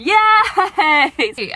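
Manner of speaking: loud, high whoop; excited cheer. Real, not acted. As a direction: cheer like you won. Celebration cheer.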